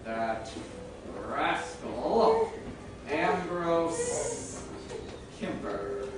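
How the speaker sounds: very slow, soft, word by word; writing aloud. Acted. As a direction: say each piece as the pen catches up, long pauses. I wanna write a note.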